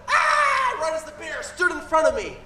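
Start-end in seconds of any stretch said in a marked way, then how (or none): 0.1-0.8 playful hammed-up scream; startled scream. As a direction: shriek as the bear looms up.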